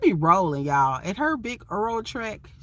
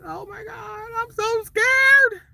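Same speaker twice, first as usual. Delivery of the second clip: high, slow, whimpering mock fright; teasing herself as a frightened driver, voice way up; mock scared; 14 semitones higher, 4 dB louder, slower than usual. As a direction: ham up being terrified of the trucks. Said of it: "and I'm like".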